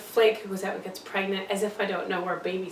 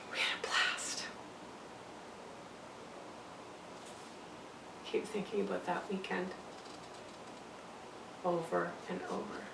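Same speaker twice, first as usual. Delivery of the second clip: near-whisper, much softer; haunted hush; 4 semitones lower, 15 dB quieter than usual. Acted. Mother dwells on the drinking weekend, hushed. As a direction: drop to a private murmur, dwelling on it.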